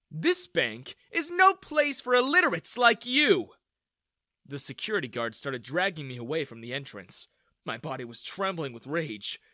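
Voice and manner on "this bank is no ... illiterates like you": high, loud scornful put-down; contemptuous insult. Acted. Daniel dismisses the girl, contemptuous. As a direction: spit it at her.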